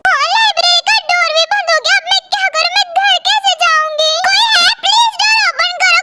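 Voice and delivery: put-on high, anxious, pleading girl's voice; frightened student locked in, begging someone to open the door; scared girl trapped alone. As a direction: call out for help, afraid and near tears. Girl trapped in library, panicking.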